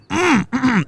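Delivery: high closed-mouth choking hums; mimed choking grunts. Retold or imitated. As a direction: grunt urgently as if unable to speak. Imitates friend unable to speak, choking.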